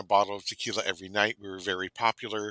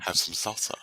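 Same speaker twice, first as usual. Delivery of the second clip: seductive offer; 2 semitones lower than usual. Pretend. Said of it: "making it for someone else".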